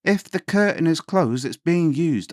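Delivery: loud and stern. Retold or imitated. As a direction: set the boy straight, loud. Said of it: "in a fairly loud and stern voice".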